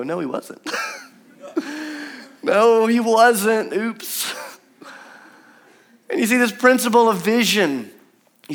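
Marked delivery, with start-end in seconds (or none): 0.0-0.6 mock dismay. Mock realisation after jumping. 1.4-2.1 a short high giggle; light laugh. Real, not acted. Laughs at own joke. 2.4-4.3 slow comic drawl. Acted.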